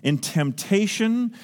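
ordinary delivery

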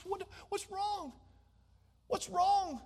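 God softly pleading with Cain to do right. Acted. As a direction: beg him gently to come round. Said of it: "He's pleading with this".

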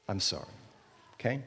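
sincere, hushed and low; heartfelt apology. Shown as a demonstration. Preacher shows the congregation a heartfelt sorry, softly sincere. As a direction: drop low and soft, truly apologizing. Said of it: "this is a sincere, heartfelt recognition".

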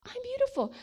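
very high, gleeful marveling; giddy wonder at oneself; delighted self-admiration. Retold or imitated. Acting out delighted self-discovery.

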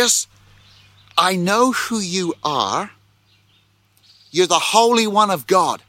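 the spirit's cry read with rising urgency; dramatic demon voice. Acted. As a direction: cry it out like the possessed man.

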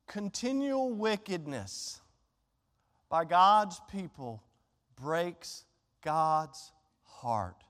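each phrase dropped slowly and low; slow and heavy. Acted. Preacher pausing to press the point, slow and heavy. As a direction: let the words sink, slow and sorrowful.

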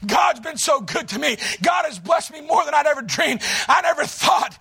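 loud, high shouted sermon delivery; full-force preaching. Acted. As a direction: preach it like revival fire, calling the church to wake up.

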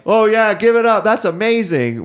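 hype pastor. Retold or imitated. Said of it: "the pastor baptizing them ... is like".

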